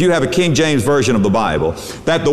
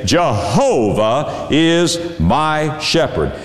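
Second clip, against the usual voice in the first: each word set apart slowly, stress on "Jehovah"; slow, weighted declaration; much slower than usual. Shown as a demonstration. Preacher substituting the sacred name, slow and emphatic on "Jehovah". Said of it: "And you can say".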